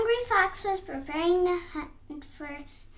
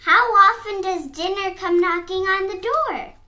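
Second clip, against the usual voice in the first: louder, higher exclaimed question; delighted shout; 4 semitones higher, 7 dB louder than usual. A narrator's reading. Fox delighted dinner came knocking, shouting. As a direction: marvel out loud at the luck. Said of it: "the fox shouted".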